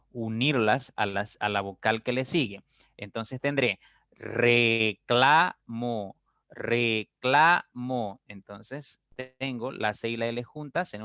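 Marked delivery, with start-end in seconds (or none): 3.0-6.2 "reclamo" stretched slowly, syllable by syllable; slow syllable split. Shown as a demonstration. Teacher pronouncing slowly to split syllables. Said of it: "pronunciar pausadamente". 6.5-8.2 "reclamo" very slow, each syllable apart. Shown as a demonstration. Teacher repeats the split for the class. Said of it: "pronunciar pausadamente".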